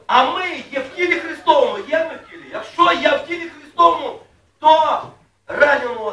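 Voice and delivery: loud emphatic sermon line; fervent preaching. Acted. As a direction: drive the point home to the congregation.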